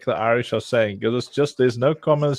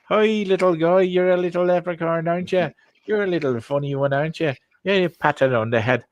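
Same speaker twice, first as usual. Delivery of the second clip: higher, patronising voice for a little leprechaun; mock Irish talking to a leprechaun; 6 semitones higher, faster than usual. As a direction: talk down sweetly to a tiny leprechaun. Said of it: "The Irish".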